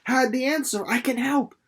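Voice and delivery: put-on a girl rushing out her plan; high eager young Winnie. Winnie voiced higher, eager.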